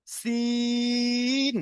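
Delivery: সিন drawn out long and thin against the teeth; long thin sin. Shown as a demonstration. Teacher modelling সিন for students. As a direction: stretch সিন for three alif. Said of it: "সিনটাও তিন আলিফ টান".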